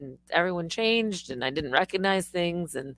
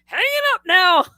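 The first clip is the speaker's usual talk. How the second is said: high, loud abrupt dad voice; mock dad hanging up; dad's curt sign-off; 13 semitones higher, 7 dB louder than usual. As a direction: end the call like a blunt father. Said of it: "when he's, like, done with the conversation".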